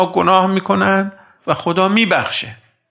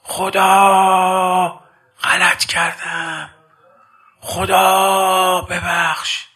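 retold cry out; 3 semitones higher, much slower than usual. Schoolboy shouting to God mid-play, long drawn-out plea. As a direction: stretch each word like a boy crying out in remorse. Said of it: "داد زد".

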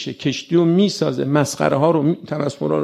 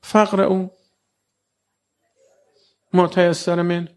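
God inviting; 6 semitones higher than usual. Acted. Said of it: "میگه".

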